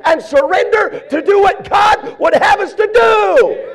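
high, loud, driving revival preacher; full-force preaching. Real, not acted. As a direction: shout it in rhythmic bursts from the pulpit.

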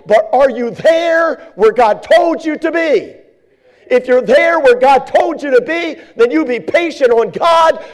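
high, shouted exhortation, slowing on the last commands; fiery preaching. Acted. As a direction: drive it home like a revival preacher.

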